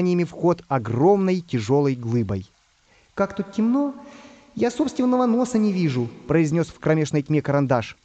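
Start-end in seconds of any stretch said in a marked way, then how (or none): 3.1-6.1 high-pitched storybook character voice; higher character voice, fretting; Pencil in the dark. Acted. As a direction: complain in a high little cartoon voice.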